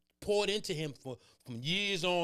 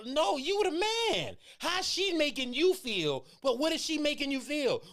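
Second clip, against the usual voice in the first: challenging; 10 semitones higher than usual. Retold or imitated. Father challenging son.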